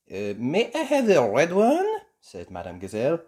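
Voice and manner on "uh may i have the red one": high, polite French lilt; French teacher voice. A narrator's reading. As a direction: ask sweetly. Gazelle asks.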